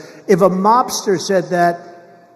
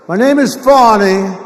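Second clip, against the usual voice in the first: mock French accent on "Fani", louder and higher; a little French accent; 4 semitones higher, 9 dB louder than usual. Imitating a prosecutor introducing herself, mock French accent. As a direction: put a light, hammed-up French lilt on "Fani". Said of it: "She put a little French accent".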